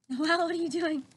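ordinary delivery

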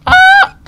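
high scream of fright. Real, not acted. Jump scare in horror game.

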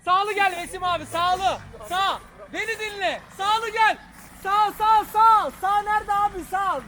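loud urgent shouting directions. Real, not acted. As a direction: yell the directions over the engine. Spotter yelling directions at driver.

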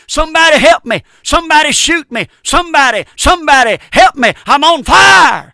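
high, loud anguished screams; screaming from hell. Acted. Preacher voices a damned soul screaming in hell. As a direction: wail as a soul burning in hell. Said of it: "in hell right now saying".

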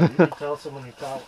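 ordinary talk

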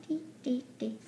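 ordinary talk, nothing marked

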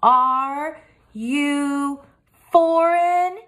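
over-enunciate foreign at him; loud and slow. A narrator's reading. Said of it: "spoke loudly and slowly".

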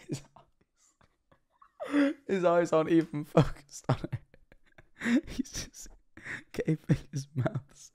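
words breaking up with real laughter; helpless laughter. Real, not acted. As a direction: try to talk while cracking up. Cracking up at the clip.

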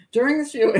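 can't hold back the laugh; laughing through it. Real, not acted. Reading the grim report, giggling. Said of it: "I shouldn't laugh".

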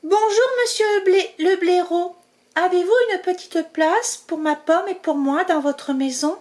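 high, bright-eyed mouse voice asking politely; a tiny mouse asking a favour; small hopeful mouse voice; polite little mouse. Acted. Zazie speaking.

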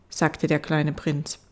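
usual speaking voice

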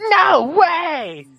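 high, drawn-out mock shout; hammed-up cry of a melodramatic line; intense quoted shout. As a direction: belt it out in over-intense imitation. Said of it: "He's so intense about it".

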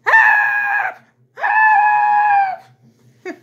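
very high, loud panicked cries of help; screaming for help. Retold or imitated. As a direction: scream help like a terrified victim. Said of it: "the guys are screaming".

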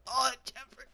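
high, loud wail to Jeffrey; screaming. Real, not acted. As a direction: scream out in alarm. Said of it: "I'm screaming".

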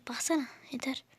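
whispered, fed-up aside; quiet fed-up whisper at the game; exasperated whisper. Real, not acted. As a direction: hiss it under your breath. Character keeps jumping.

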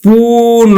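পুন drawn out, ending on o; final visarga as o. Shown as a demonstration. Teacher showing students the o-kar, drawn-out পুন ending in o. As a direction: stretch the vowel of পুন and close on a clear o. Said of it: "আমরা উচ্চারণ করি কিন্তু ওকার".

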